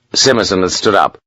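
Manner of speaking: plain reading voice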